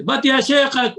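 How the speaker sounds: loud, high, cutting in on the teacher; rude interruption. Shown as a demonstration. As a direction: barge in rudely, raising your voice. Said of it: "do not say".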